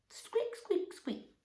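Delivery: soft, high, tiny squeaks; mouse squeak. Pretend. Teacher cueing children in the fable, small squeaks. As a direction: squeak softly like a little mouse. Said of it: "best mouse with that squeak".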